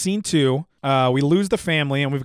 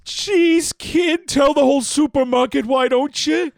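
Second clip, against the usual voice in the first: angry shout; 11 semitones higher, 4 dB louder, slower than usual. Acted. Al yells at the clerk.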